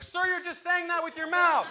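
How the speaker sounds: put-on high, slow, squeaky kid voice; deadpan the teen calling him out; teen ride attendant. Ride kid catching him faking the clicks, slow and squeaky. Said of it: "he just goes".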